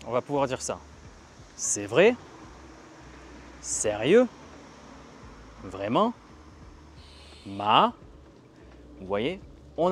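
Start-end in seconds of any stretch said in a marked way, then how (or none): 1.6-6.1 demonstrated astonishment. Shown as a demonstration. Teacher showing astonishment as a trick for the second tone, surprised. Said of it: "Ça ressemble à l'étonnement". 7.5-7.9 MA swooping up loud, like a surprised question; astonished rising tone. Shown as a demonstration. Teacher turning French astonishment into the second tone, surprised. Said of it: "ça va être tout ce qui est l'étonnement".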